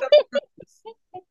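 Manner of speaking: high, slow put-on voice. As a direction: say it in a silly put-on voice. Mock ordering voice.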